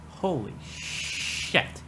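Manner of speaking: slow breathless whisper; slow hushed holy shit; whispered awe. Real, not acted. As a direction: whisper it, overwhelmed. Overwhelmed by cuteness.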